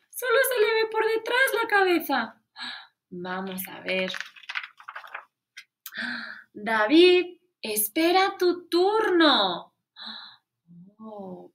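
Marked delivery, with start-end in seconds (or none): 0.1-2.4 put-on child's voice. Voicing a classmate. 6.5-9.7 firm teacher rebuke. Acted. Teacher scolds David.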